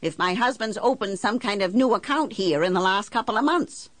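high-pitched, exasperated argument; indignant, demanding tirade; heated insistence. Acted. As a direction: press the banker hard for an answer. Argues heatedly with banker.

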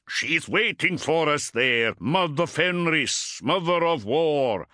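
high, slow fervent chant; rally the warriors with zeal; battle cry. A narrator's reading.

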